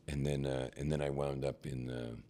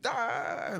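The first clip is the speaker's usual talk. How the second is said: mock scream, much higher. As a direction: shriek along. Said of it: "We're both screaming".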